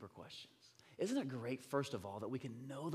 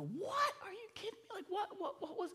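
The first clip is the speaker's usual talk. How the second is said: very high, stammering astonishment; stunned disbelief. Retold or imitated. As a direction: act out a dad who can't believe it. Acts out stunned dad's reaction.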